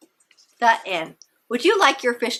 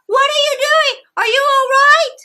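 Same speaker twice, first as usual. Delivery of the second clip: worried yell; 8 dB louder than usual. A narrator's reading. Boy yelling into the pool.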